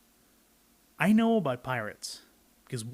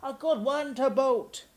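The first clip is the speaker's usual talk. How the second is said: much higher, slow chorus of pirates; pirate crew agreeing; 11 semitones higher than usual. Acted. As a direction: echo it like a gang of sailors. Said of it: "the others agreed".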